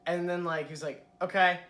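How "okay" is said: blunt loud "Okay". Retold or imitated. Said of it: "it was the nice voice, and then".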